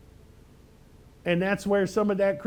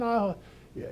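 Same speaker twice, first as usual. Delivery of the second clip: ordinary talk, nothing marked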